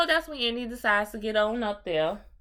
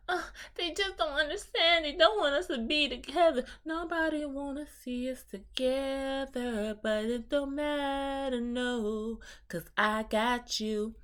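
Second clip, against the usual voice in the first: mock tearful plea, higher and slowed; imitating crying; 5 semitones higher than usual. Indy tells her jailed boyfriend it through mock tears. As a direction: whimper it out like a lovesick girl. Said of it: "in the midst of her crying".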